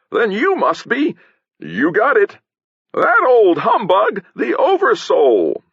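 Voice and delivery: high, slow theatrical exclaiming; hammed-up stage actor. A narrator's reading. Potion seller unmasking the Oversoul on stage, hammed-up exclaiming. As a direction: draw it out in grand mock discovery.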